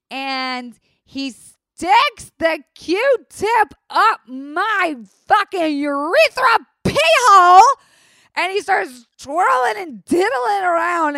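outraged telling. Real, not acted. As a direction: build the outrage word by word, slowly. Outraged retelling of painful exam.

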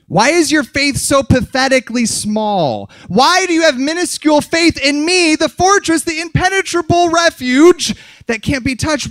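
high, loud, exasperated tirade; scolding rebuke. Acted. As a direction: build into an incredulous shouted rebuke. Jesus' rebuke acted as a tirade.

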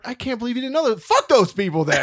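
high-pitched outburst, breaking into laughter; retold comic's gleeful indignation; indignant disbelief. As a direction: blurt it out, amused and appalled. Comedian indignant on her behalf.

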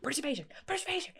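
high, rushed whispery squeal; mock excited scream. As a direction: imitate mom screaming without raising your volume. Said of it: "She'd, like, scream".

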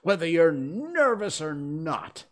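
high, loud royal threat; blustering, enraged king; angry. A narrator's reading. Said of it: "the king repeated angrily".

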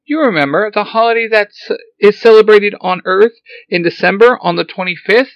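high, loud robot voice; robot explaining. Acted. Alpha Five reminding Zordon what Christmas is, high robot voice. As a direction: explain it brightly like a helpful robot.

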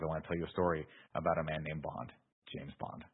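hushed storyteller's reveal on the name; understated dramatic quote; quoted dramatic line. Retold or imitated. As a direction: quote it like a film's closing line, dropping very soft. Quoting film's dramatic closing line.

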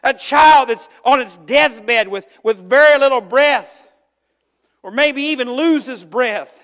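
loud, high impassioned preaching; preacher lifting the congregation; full-force preaching. Acted. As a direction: preach it out with fervour.

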